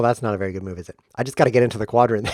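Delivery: ordinary talk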